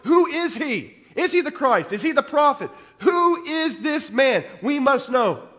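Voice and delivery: high, loud, urgent questions from the crowd; rising to a shout on who is this man; anxious crowd demanding answers, shouting; confused crowd clamour. Retold or imitated. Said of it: "Everybody's like".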